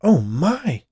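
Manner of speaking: high, loud shocked exclamation. A narrator's reading. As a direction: react in startled shock.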